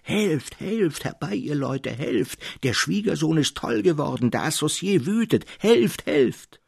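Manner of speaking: high, loud panicked cries for help; screaming beyond measure. A narrator's reading. Elias being shaken, screaming for the neighbours in panic. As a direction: shriek for help as if attacked. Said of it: "schrie aber über alle Maßen".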